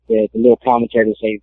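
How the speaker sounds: normal talking voice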